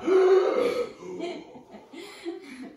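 mock breathless heavy gasp; exaggerated panting gasp. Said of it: "y'all would be like this now".

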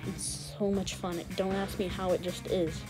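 usual speaking voice

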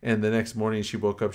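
normal talking voice

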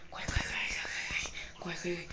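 whispered, hurried urging; urgent whisper. Real, not acted. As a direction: hiss it under your breath to run. Fleeing animatronics, hushed take. (sussurro apressado)

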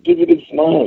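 high-pitched mock Elaine; imitating Elaine. Retelling Elaine's advice to George.

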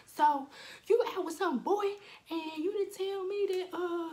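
imitating yelling dad. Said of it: "like, yelling".

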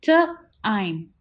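T-ime split into onset and long vowel; segmented. Shown as a demonstration. Teacher sounding out T-ime for the class. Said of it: "Say it out loud".

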